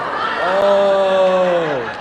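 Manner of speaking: loud laughing oh; a laughing oh of relief; laughing realization. Real, not acted. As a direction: break into laughter at the mix-up. Realizes it was the dog.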